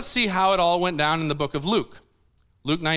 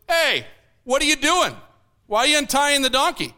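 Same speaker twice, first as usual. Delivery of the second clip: owner's challenge; 6 semitones higher than usual. Retold or imitated. Donkey owner challenges disciples.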